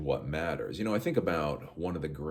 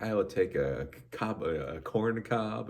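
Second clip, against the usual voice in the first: mock girl voice; 5 semitones higher, slower than usual. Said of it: "the girls are very like".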